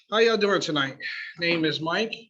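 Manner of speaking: in front of an audience. Real, not acted. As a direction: open up to the whole room. Restarts greeting to the room.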